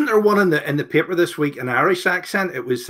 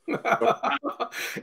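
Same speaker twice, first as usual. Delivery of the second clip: high, softer run of laughter; real laughter; 7 semitones higher, 8 dB quieter than usual. Real, not acted. Host amused that the golfer is thought to be from Hollywood, laughing. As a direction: chuckle high and quick.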